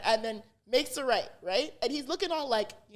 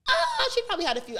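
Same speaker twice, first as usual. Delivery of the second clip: laughing; 12 semitones higher, 3 dB louder, faster than usual. Real, not acted. Laughing at the question.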